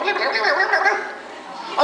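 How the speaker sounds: high, laughing blah-blah babble; teen mocking the excited youth worker's chatter. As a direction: parody someone yammering on.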